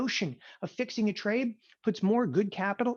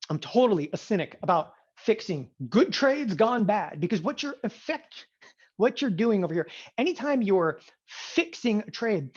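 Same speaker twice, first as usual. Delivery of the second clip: ordinary delivery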